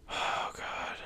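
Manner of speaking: a drained, whispered sigh of Oh God; spent, sighing whisper; exhausted whisper. Real, not acted. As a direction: breathe it out with nothing left. Exhausted after a furious day.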